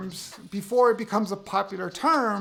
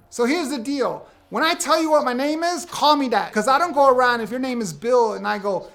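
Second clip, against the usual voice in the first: normal talking voice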